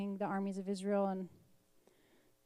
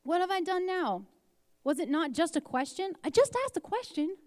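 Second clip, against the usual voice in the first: much higher, louder, put-upon pleading; whiny defensive kid brother; 7 semitones higher, 4 dB louder than usual. Acted. David pleading with Eliab not to flip out, whiny and high. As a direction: protest your innocence to an angry sibling.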